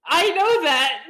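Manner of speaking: loud, high comeback; exasperated protest. Real, not acted. Player answering the game master's reminder, loudly exasperated. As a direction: protest it loudly, half in fun.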